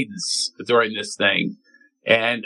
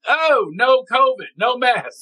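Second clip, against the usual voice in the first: mocking carefree attitude; 10 semitones higher, slower than usual. Mock restaurant no covid attitude.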